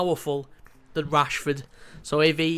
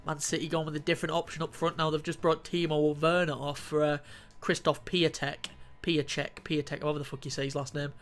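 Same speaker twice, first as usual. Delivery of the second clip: ordinary delivery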